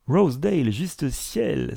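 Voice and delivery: taken-aback outburst; shocked exclamation. A narrator's reading. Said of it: "s'écria Van Alstyne".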